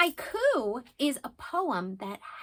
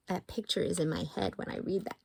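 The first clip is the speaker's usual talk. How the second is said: usual speaking voice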